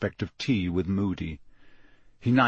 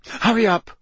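high, clipped urging; tense; 11 semitones higher, 4 dB louder than usual. Acted. Hermione hurries Harry and Ron, tensely. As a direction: rush them along, tight and anxious. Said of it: "she said tensely".

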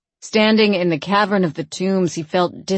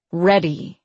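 plain reading voice

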